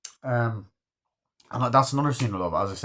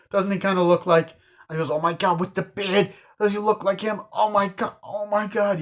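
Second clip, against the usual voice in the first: amazed laughter; 9 semitones higher, 3 dB louder than usual. Retold or imitated. Noticing the painting looks like victim.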